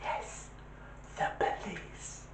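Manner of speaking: put-on slow, hushed little child; little whispered voice. Little child telling a caller about police, whispering. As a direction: whisper it slowly, like a secretive kid. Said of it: "a little voice answered".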